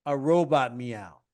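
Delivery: normal talking voice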